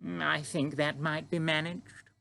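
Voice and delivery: Queen indulging Sophie's breakfast wish; smiling Queen. A narrator's reading. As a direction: let a smile into the royal voice.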